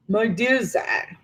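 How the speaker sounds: slow, soft mock-imposing rumble; rumbling monster. A narrator's reading. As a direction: try to sound dramatic and deep. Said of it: "a rumble deep in his chest".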